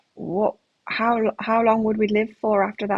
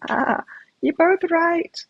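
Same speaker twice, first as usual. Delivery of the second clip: high, drawn-out mock verdict; playful peacemaking; 8 semitones higher, 3 dB louder, slower than usual. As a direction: draw it out high, playfully settling both sides. Acting out her diplomatic reply.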